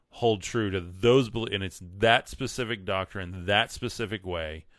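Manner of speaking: usual speaking voice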